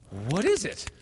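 high, loud, alarmed question; fearful cry. A narrator's reading. Peter, assailed by fear, cries out to Wendy in alarm. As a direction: cry out as if shrinking back. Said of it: "he cried, shrinking".

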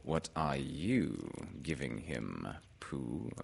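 slow, low, soft owl; Owl's character voice. A narrator's reading. Owl asks Pooh back about the present, slow low voice. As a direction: turn the question back slowly and ponderously.

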